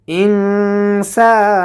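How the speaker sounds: ইনসানা with the ন hidden in a long nasal hum; nasal ikhfa. Shown as a demonstration. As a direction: hold the ng of ইনসানা through the nose. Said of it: "নাকের ভেতর লুকায়ে গুণনা করব". (ইনসানা শব্দে নাকের ভেতর লুকিয়ে গুন্নাহ)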